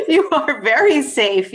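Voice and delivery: usual speaking voice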